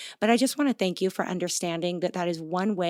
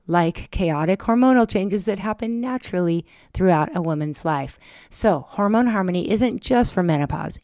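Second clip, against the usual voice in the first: ad read; 5 dB louder than usual. Acted. Sponsor ad read.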